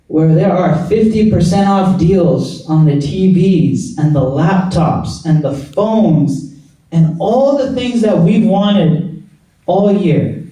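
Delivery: usual speaking voice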